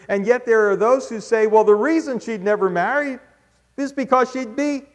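loud, high preaching build; preaching at full force. Acted. Preacher voicing the critics' claim, loud and high. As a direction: build up to the punchline.

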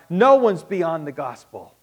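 firm, loud proclamation; ringing pulpit declaration. Real, not acted. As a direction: hit it hard to the room. Loud statement then soft repeat.

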